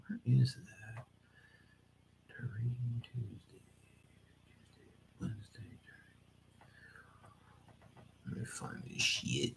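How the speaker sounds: whispered under his breath; whispering while looking for his notes; whispering to himself. Real, not acted. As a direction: mouth the words while searching.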